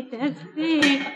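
embarrassed protest. Acted. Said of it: "זה לא נעים לי".

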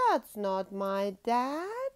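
slow, loud line rising at the end; dismayed cub. A narrator's reading. Patty finding the wrong animal, slow and loud. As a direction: draw it out loudly as a let-down discovery. Cub finds it is not dad.

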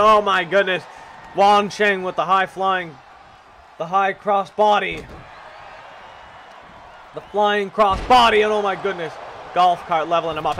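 loud, hyped wrestling announcer call; play-by-play commentary. Acted. Commentator calling a wrestling match to viewers, hyped play-by-play. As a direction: sell every move like a ring announcer.

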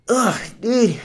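put-on high, whiny kid grumbling at his friend; a high-pitched whine of an eighties kid telling off a buddy. Reviewer quoting the kid in It, high whiny eighties kid. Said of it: "it just sounded so eighties".

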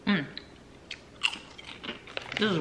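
chewing while talking; muffled by food; mouth full. Real, not acted. Seen 1: Bites crisp at -3, hand at mouth chewing until about 2s.

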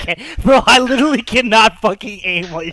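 loud, high exasperated outburst; exasperated yell. Real, not acted. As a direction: yell it, fed up and laughing. Exasperated at teasing.

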